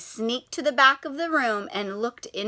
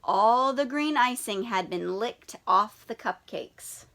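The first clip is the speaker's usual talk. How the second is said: plain reading voice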